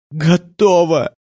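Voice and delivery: high, drawn-out defiant cry; acted fierce exclamation; cried out. A narrator's reading. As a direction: call it out slowly at high pitch, frenzied. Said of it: "выкрикнула она".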